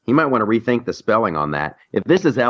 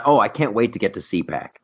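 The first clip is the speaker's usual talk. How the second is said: ordinary delivery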